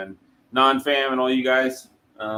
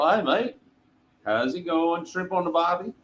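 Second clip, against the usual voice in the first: a light, hammed-up Australian accent, pitched higher; mock Australian accent; 5 semitones higher than usual. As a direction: tease a coworker with a cheesy Australian greeting. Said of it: "I used to drive him crazy every morning. I go".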